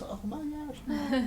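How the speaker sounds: drawn-out high oh my gosh, slow and soft; overwhelmed at the thought of eight hours; mock dismay. Coach groaning at an eight-hour task, drawn-out mock dismay.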